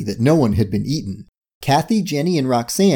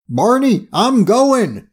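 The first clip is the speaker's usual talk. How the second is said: urgent call; 10 semitones higher, much slower than usual. A narrator's reading. Getaway driver calls to partner.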